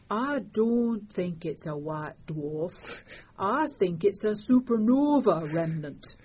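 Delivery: put-on slow, broad Yorkshire accent; lightly voiced Yorkshire accent. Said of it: "[name] said in his best Yorkshire accent".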